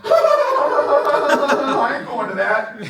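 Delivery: high, laughing mock whine of a reluctant recruit; scoffing high-pitched mock; mocking quitter. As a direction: squeak out the lazy excuse. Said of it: "They're like".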